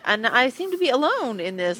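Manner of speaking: ordinary delivery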